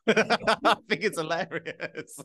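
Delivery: slow, laughing delivery; laughing while talking. Real, not acted. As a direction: talk through a laugh.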